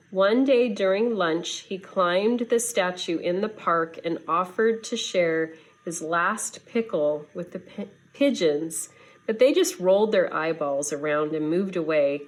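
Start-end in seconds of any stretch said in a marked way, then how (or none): none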